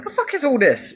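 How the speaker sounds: high, incredulous mock voice; body asking in a high cartoon voice; voicing your own body. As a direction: squeak it like a baffled body. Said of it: "your body's going".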